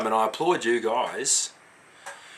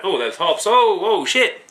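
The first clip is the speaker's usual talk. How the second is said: sniffs, then a sudden higher, louder oh shit; startled by the smell of the beer; retold surprise; 6 semitones higher, 3 dB louder than usual. Retelling sniffing his mate's stale beer, surprised. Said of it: "and I went".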